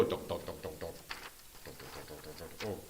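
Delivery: low, soft "dunk, dunk" of trudging troops; tiny army tramping off, hushed and low; marching footsteps. Retold or imitated. Teacher marching an army across the map, low soft footstep noises.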